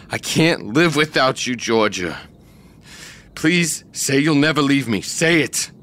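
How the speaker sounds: desperate pleading. Acted. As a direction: beg her not to leave. Joe begs desperately.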